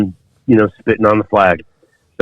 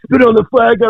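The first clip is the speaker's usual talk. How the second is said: yelled order; 14 semitones higher than usual. Retold or imitated. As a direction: bark the order down at him. Drill instructors yelling order.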